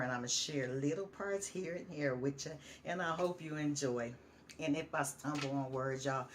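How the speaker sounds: ordinary delivery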